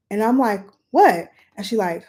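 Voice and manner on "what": loud startled what; sharp what. Retold or imitated. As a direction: snap back in alarm. Reacting to friend.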